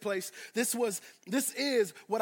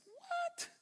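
incredulous; 10 dB quieter than usual. Acted. Incredulous outburst in role.

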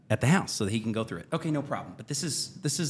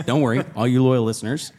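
loud, playful announcement; mock reassurance; 8 dB louder than usual. Host telling loyal listeners he survived, loud mock reassurance. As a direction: proclaim it to the whole audience.

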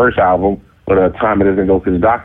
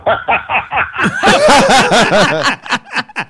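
long, high-pitched laugh; laughter; 13 semitones higher than usual. Real, not acted. Storyteller reaching the opened door, bursting into laughter.

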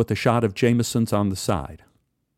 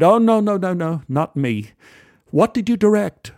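lightly English, higher and louder; a polite English gent's greeting; English accent; 7 semitones higher, 3 dB louder than usual. Retold or imitated. English stranger greets narrator at the bar, lightly English. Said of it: "He, he was English".

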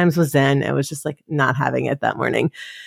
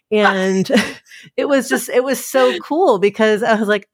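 usual speaking voice

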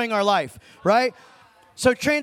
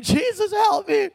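high, pleading cry to Jesus; a raised, tearful call for help; retelling his breakdown in the car, crying out; retold crying plea; 10 semitones higher, slower than usual. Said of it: "I'm crying".

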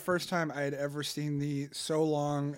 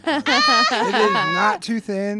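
mock indignant; 8 semitones higher, 12 dB louder than usual. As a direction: protest in hammy indignation. Mock defending the episode.

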